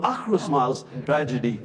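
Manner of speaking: ordinary delivery, nothing marked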